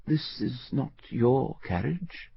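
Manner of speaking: mild. Acted. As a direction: object mildly to the intruders. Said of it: "he said mildly".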